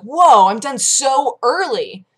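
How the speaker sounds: thrilled to be finished early; drawn-out happy exclaim; excited surprise. Retold or imitated. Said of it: "I was really excited".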